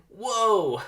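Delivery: high, loud whoa then laugh; thrilled whoop and laugh; excited whoa. Acted. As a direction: yelp with delight. Barry flies through kite.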